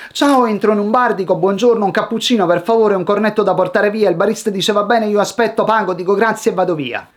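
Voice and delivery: one breathless run without gaps; hurried learner racing through the whole story; rushed, no pauses. Shown as a demonstration. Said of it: "let me give you an auditory example".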